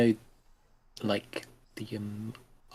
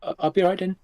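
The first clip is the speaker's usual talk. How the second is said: calling back; 8 semitones higher, 9 dB louder, much faster than usual. Acted. Liberty calls back to parent.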